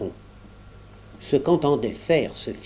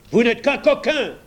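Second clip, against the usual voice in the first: higher, louder yell; 9 semitones higher, 4 dB louder than usual. A narrator's reading. Pat yells at the clerk, furious yell. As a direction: hurl the insult at him. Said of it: "hurla-t-il".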